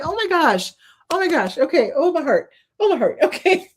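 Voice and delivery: high, choked-up laughing gasps; joy spilling over into tears; real happy tears, laughing through them; overwhelmed with joy. Real, not acted. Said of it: "like, pull it together".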